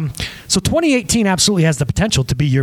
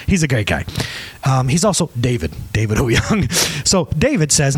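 ordinary talk, nothing marked

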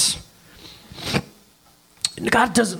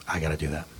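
quiet resolve; 13 semitones lower, 11 dB quieter, faster than usual. Acted. The Father's answer, much softer.

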